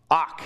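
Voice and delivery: ak said short and sharp, with a hard k; ak, crisp and clipped; saying ak. Shown as a demonstration. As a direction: punch out the Hebrew word ak. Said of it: "You can say that if you want".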